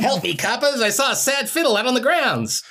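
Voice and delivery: high, loud comic panic; mock cry for police. Caller reporting a sad fiddle to the coppers, hammed-up panic. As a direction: yell for the coppers in a hammed-up squeal.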